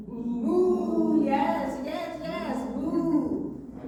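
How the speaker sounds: loud, high, sung moo; cow mooing. Acted. Cow answers the boy with a loud sung moo. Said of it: "And the cow said".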